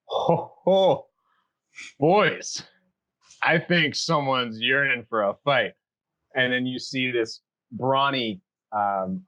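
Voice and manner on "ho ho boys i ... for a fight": lightly voiced cocky tiefling. Tiefling turning to his group, mocking. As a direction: tease his friends with it. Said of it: "He says".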